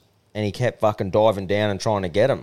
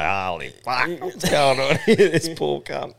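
amazed onlooker; 5 semitones higher than usual. Retold or imitated. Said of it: "sit back and go".

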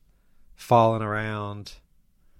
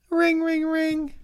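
very high sing-song ringing; phone ring imitation; slower than usual. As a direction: chirp it like a phone.

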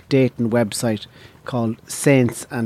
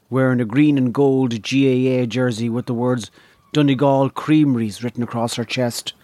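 plain reading voice

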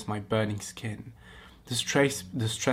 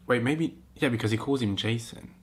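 ordinary talk, nothing marked

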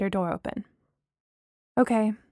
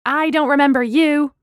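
loud, raised-pitch distrust; suspicious; 4 semitones higher, 6 dB louder than usual. Acted. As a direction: challenge him, unconvinced. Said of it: "Danny said suspiciously".

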